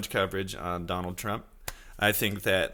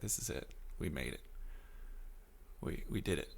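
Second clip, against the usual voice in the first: much softer, hushed mock reverence; soft awestruck murmur; hushed awe; 6 semitones lower, 16 dB quieter than usual. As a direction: breathe it out like a quiet miracle. Awestruck at Trump's picture.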